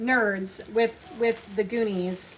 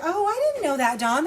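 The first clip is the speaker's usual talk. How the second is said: surprised; 6 semitones higher, 4 dB louder than usual. Real, not acted. Surprised by info.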